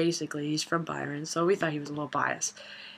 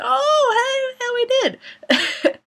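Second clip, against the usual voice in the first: high, drawn-out mock squeal; retold excited recognition; 5 dB louder, much slower than usual. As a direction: gush as if spotting an old teammate. Retold recognition of old teammate.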